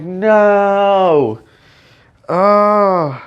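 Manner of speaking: hammed-up lament; mock anguish. Fan told they can never bring him back, mock anguished wail.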